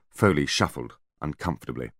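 usual speaking voice

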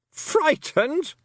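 shrill high scorn, loud on Stole; incredulous queen. A narrator's reading. A fairy queen scoffing at the girl, loud and high. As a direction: scoff at the very idea.

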